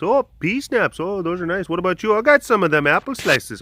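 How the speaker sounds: excited squeaky animal voice; lemur voice. Acted. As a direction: chirp it like a delighted little lemur. Voicing second lemur.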